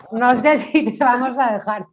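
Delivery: loud, high, through laughter; mock refusal bubbling with laughter; laughing tease. Real, not acted. Panelist jokingly refusing the audience member's request, laughing tease.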